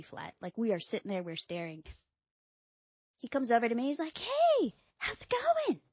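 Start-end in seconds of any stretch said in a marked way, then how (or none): 4.2-5.8 high, loud sing-song greeting; mock cheerful hello; chirpy husband. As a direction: play the breezy oblivious husband. Husband paddles over cheerfully.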